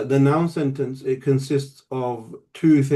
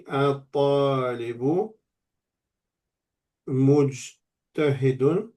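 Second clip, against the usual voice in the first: drawn-out syllables, pause between the words; slow dictation; slower than usual. Shown as a demonstration. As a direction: stretch each Arabic word as if writing along. Writing out the same sentence again.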